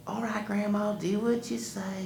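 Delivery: put-on lightly high obedient boy; a hint of boy obeying. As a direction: give in reluctantly. Wiley obeys.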